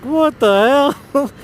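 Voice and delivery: high, loud and slow cry of disbelief; shocked exclamation. Retold or imitated. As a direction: stretch it out in stunned disbelief. Reaction to toy talking.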